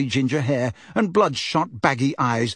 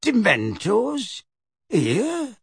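aghast; 8 semitones higher, much slower than usual. Acted.

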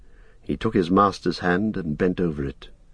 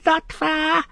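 strange creature call, leaping very high; Gollum creature voice; 9 semitones higher, much slower than usual. A narrator's reading. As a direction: call it in an eerie, squeaky creature voice. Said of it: "said a voice above him".

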